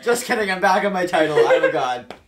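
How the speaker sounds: loud boast. Real, not acted. Boasting loudly.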